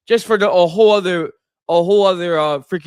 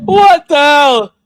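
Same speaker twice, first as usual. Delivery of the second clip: shocked reaction; 9 semitones higher, 9 dB louder, much slower than usual. Real, not acted. Reacting to that's what she said joke.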